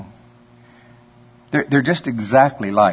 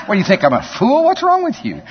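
high, louder, hammed-up outrage; mock indignant protest; 11 semitones higher, 5 dB louder than usual. Husband teasing the congregation about his wife, mock indignation. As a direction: play it as a joking, pretend-offended retort.

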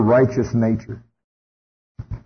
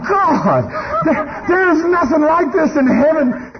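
shocked; 14 semitones higher, slower than usual. Acted. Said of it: "I'm going".

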